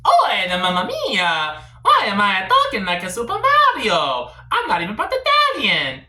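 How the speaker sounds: big hammed-up cartoon Italian voice; Super Mario. Shown as a demonstration. Comedian shows his friend how it's done, hammed-up Mario. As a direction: play it loud with exaggerated pizzazz. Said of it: "do it more like this".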